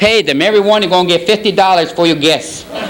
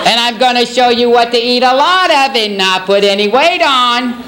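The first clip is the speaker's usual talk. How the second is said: high, swooping fitness-host voice; effusive TV trainer impression; Richard Simmons; 5 semitones higher than usual. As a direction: stretch key words upward like an exercise-show cheerleader. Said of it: "Richard Simmons".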